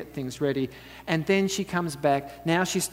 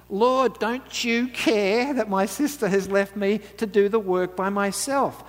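mild complaint; 6 semitones higher, 4 dB louder, slower than usual. Acted. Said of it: "she says to Jesus".

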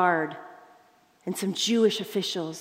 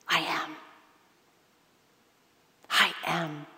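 hushed reverent; 6 semitones lower, 8 dB quieter than usual. Acted. Climactic divine name, hushed.

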